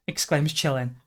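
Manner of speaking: ordinary talk, nothing marked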